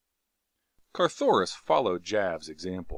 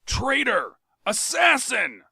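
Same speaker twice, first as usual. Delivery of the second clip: high furious scream; screaming accusation; 9 dB louder, slower than usual. Acted. Tario screaming at the traitor Jav. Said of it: "he screamed".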